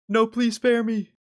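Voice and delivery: high, loud mock plea for mercy; fluttering cries for help. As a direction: squeal a hammed-up beg to be spared. AI threatens to take over world.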